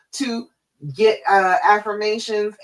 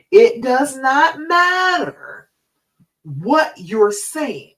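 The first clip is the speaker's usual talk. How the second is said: slow, higher and louder on NOT MATTER; emphatic point; 6 semitones higher, 5 dB louder, much slower than usual. Real, not acted. Coach stressing to viewers that words repeated matter. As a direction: hammer home that it does not matter.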